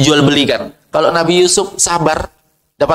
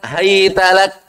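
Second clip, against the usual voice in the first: slow Quran recitation; much slower than usual. Acted. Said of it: "Ditambah kalimat".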